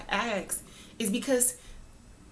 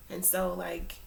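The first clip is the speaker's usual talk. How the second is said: usual speaking voice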